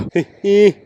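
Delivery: short high laugh; real bright laugh; burst of laughter. Real, not acted. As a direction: crack up at the sight. Laughs at an odd passerby.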